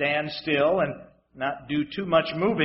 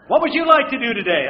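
higher, louder mock-bright question; mock cheery question; 6 semitones higher, 8 dB louder, faster than usual. Preacher mocking the crowd, playing a cheerful leader, higher and louder. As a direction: ask it brightly, like a cheerful host.

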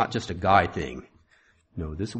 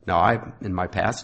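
usual speaking voice

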